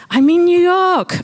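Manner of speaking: high, loud thrill; excited high exclamation; elated self-reminder. A narrator's reading. Reminds herself, elated, in story.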